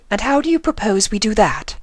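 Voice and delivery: high, elegant lady voice; Rarity's voice. A narrator's reading. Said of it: "said Rarity".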